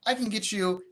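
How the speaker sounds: cheerful agent announcing the offer; upbeat, confident. Shown as a demonstration. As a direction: sell it like a win.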